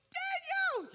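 a high, strained wail of Daniel; anguished cry. Acted. Preacher as Darius calling into the den, anguished high cry. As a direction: cry the name out in hammed-up anguish. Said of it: "Y'all like my anguished voice?".

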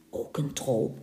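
a hushed whisper to the listener; whispered aside. A narrator's reading. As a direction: whisper it like a secret. Fluistert terzijde tegen luisteraar. (fluisterend terzijde)

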